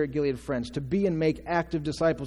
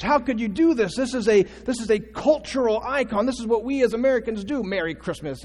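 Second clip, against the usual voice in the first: higher-pitched, indignant protest; mock outrage; 7 semitones higher, 4 dB louder than usual. Preacher voicing people upset over holiday greetings, mock outrage. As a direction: imitate affronted people, pitch pushed up. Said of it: "they, they were outraged".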